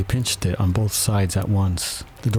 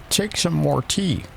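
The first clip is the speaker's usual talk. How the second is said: high, slow, insistent offer; very earnest; 9 semitones higher than usual. A narrator's reading. March Hare offering Alice tea, earnest. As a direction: press it on her slowly and high. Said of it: "said to Alice very earnestly".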